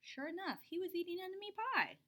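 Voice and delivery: ordinary delivery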